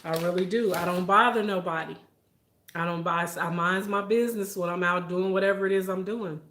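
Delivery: ordinary delivery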